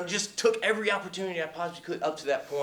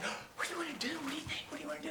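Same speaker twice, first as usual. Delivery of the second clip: imitating her in a breathy whisper; hushed whisper; 10 semitones higher, 16 dB quieter, much faster than usual. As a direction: whisper the questions, much softer than usual. Retells co-star asking softly.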